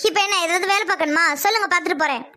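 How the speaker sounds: ordinary delivery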